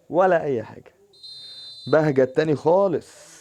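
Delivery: loud gloat. Real, not acted. Gloating at the game.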